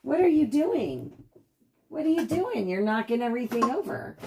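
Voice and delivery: high, playful pet-talk scolding; mock scolding the dog. Real, not acted. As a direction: tease the puppy up high.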